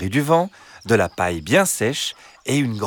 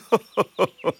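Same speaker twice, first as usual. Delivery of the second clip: high, dismissive chuckle; fox's put-on laugh; 9 semitones higher than usual. A narrator's reading. Fox laughs off the hens' fear, put-on laugh.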